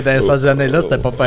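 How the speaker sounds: normal talking voice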